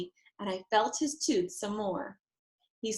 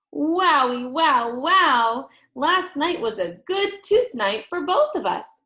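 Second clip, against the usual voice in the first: high, loud gleeful wowie; thrilled; 6 semitones higher, 7 dB louder than usual. A narrator's reading. Girl telling parents, overjoyed. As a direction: burst with joy. Delighted exclaim.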